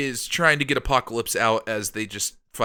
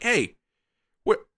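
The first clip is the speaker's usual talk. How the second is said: high-pitched, incredulous mock shout; hammed-up, high exasperated hail; mock calling out; 11 semitones higher, 9 dB louder, slower than usual. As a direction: call up in comic disbelief. Acted calling up to the castle.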